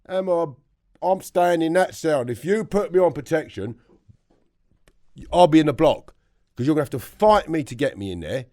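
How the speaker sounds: loud, high-pitched refusal; defiant retort. Retold or imitated. Inmate refusing protection to prison staff, defiant and loud.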